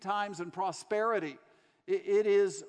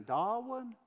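put-on soft Southern accent; 4 dB quieter than usual. Church lady to a prisoner in his cell, soft Southern accent. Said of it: "say that same thing".

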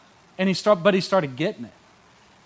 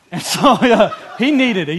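chuckling through the words, pitched up; laughing; 7 semitones higher, 9 dB louder than usual. Real, not acted. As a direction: laugh it off while admitting it. Laughing at own confession.

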